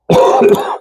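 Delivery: a short, dry cough from a chest cold; real sick cough. Real, not acted. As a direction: cough once, really sick. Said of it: "I'm getting over a cold".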